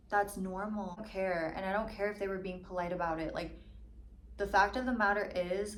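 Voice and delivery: ordinary talk, nothing marked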